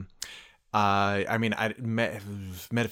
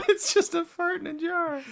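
laughing; 3 dB louder than usual. Real, not acted. Laughing high squeal.

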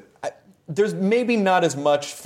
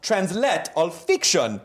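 higher, mock Italian accent in broken English; put-on Italian accent; 6 semitones higher than usual. Shown as a demonstration. Host reads on from fan letter, mock Italian accent. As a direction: read it as an Italian fan would. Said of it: "I'm reading this the way it was written".

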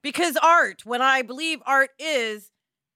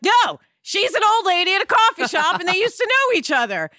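higher, louder mock reply; friend's retort; 9 semitones higher, 8 dB louder than usual. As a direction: toss it back insistently. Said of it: "my friend was like".

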